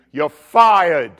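loud, punched mock dismissal; mock boss bark. Boss threatening an unfriendly employee, mock loud bark. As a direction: play the boss laying down the law loudly.

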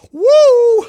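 very loud, high "woo"; startled whoop. Retold or imitated. Preacher amazed at his own mouth, startled whoop.

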